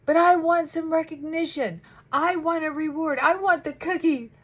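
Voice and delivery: put-on high, loud childlike wanting; childish demanding client. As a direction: beg for a reward like a kid. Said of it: "they're like".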